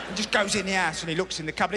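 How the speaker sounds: ordinary talk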